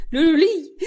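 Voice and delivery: high, loud mock ululation; celebratory ululation. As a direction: trill out a wedding cheer. (زغرودة)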